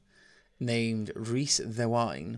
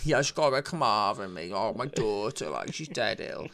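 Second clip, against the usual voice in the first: rushed sob-story from a beggar; put-on tramp, starting quick and dropping away. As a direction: spin a hard-luck tale as a tramp. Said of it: "puts on his best tramp voice".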